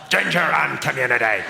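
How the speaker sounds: low, put-on Belfast accent. Shown as a demonstration. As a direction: imitate a thick Belfast accent. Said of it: "you're from Belfast".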